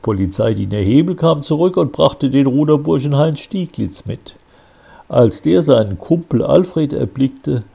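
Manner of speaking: usual speaking voice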